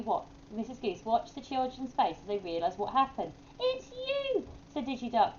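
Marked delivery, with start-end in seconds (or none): 3.6-4.5 sudden high squeak of discovery; surprised realisation. Acted. Digi Duck realising the owl is the guest, high surprised cry. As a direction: it dawns on you all at once, pitch leaping up.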